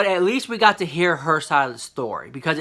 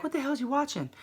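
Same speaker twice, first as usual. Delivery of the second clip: shocked; 9 semitones higher, 8 dB quieter, faster than usual. Retold or imitated. Shocked at coworker's screen.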